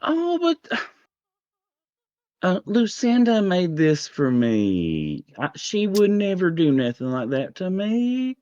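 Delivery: high, drawn-out southern queen; southern accent. Acted. Queen defending her trusted server to a guest, high southern accent. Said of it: "I've gotta do my southern accent".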